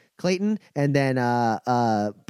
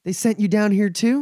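dismayed surprise; 8 semitones higher, 3 dB louder than usual. Acted. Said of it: "she goes".

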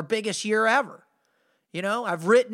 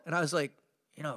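ordinary talk

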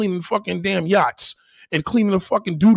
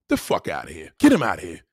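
ordinary talk, nothing marked

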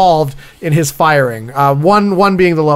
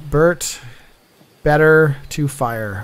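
dictating notes; 2 semitones lower, 4 dB quieter, slower than usual. Pretend. Reviewer writing down a twisted answer.

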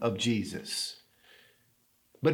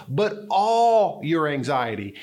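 "all" drawn out and pressed, slow and firm; stress on ALL; slower than usual. Shown as a demonstration. Pastor telling the church to give up every worry, stressing "all". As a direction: hammer home "all". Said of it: "Not just the anxiety, ... but all your anxiety".

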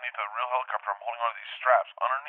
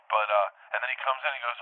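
ordinary talk, nothing marked

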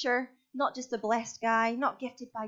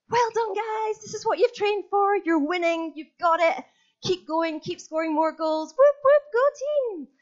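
loud, high-pitched team pep talk; cheering coach; 12 semitones higher, 8 dB louder than usual. Acted. Coach rallying a winning hockey team at half-time, loud high cheering. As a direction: whoop the team on at full pitch.

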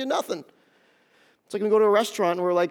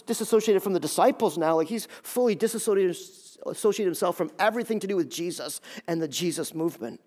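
normal talking voice

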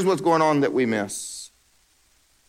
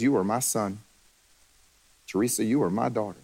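tender voice of God; 5 semitones lower, 6 dB quieter than usual. Acted.

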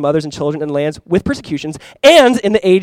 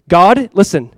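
ordinary talk

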